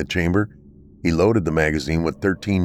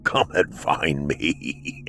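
taunting monster voice; 2 semitones lower, slower than usual. Acted. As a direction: sneer it slowly like a beast toying with prey. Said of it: "he heard a cackle".

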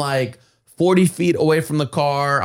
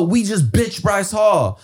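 proud shout; 7 semitones higher, slower than usual. Retold or imitated. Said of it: "They go".

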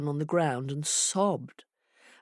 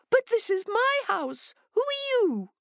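very high, squeaky hare voice; alarmed hare. Acted. Hare at her own door challenges the intruder, alarmed.

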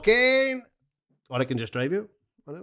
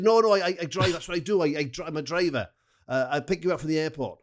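high, sing-song put-on Welsh accent; Pontypridd-Merthyr accent; 8 semitones higher than usual. Fan offering a lift from Chicago, in a high Pontypridd-Merthyr accent. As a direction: advise him in high-pitched Pontypridd. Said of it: "I'll do Miss Pontypridd, uh, slash Merthyr".

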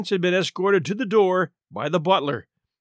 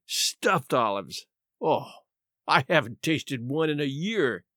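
slow, soft and low wistful sigh over "Stuffed olives"; wistful longing; 4 semitones lower, 4 dB quieter than usual. A narrator's reading. Murray dreaming of olives to the captain, softly wistful. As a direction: savour the memory slowly.

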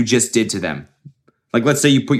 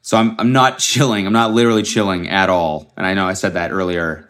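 ordinary delivery

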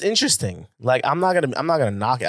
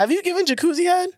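much higher, teasing disbelief; high, gleeful accusing question; incredulous question. Real, not acted. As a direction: pounce on her with a high question. Excited question to Damaris.

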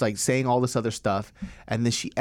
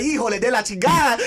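laughing Spanish exclamation; 9 semitones higher, 5 dB louder than usual. Retold or imitated. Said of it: "I was like".

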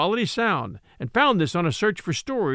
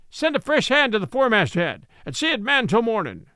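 higher, barked ship's commands; captain Ahab ordering his crew for the night, commanding and higher; captain giving orders; 3 semitones higher than usual. Acted.

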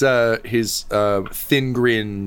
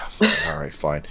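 grudging; 5 semitones lower than usual. Retold or imitated. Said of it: "slightly frustrated".

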